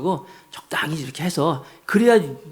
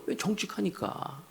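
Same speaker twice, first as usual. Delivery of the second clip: usual speaking voice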